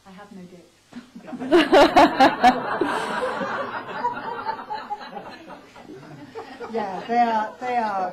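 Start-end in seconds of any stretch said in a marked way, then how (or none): 0.9-6.7 very loud high laugh; roaring laughter. Real, not acted. Actress laughing at her slip on stage, roaring. 6.7-8.1 loud words still laughing. Real, not acted. Actress recovering from laughter, laughing.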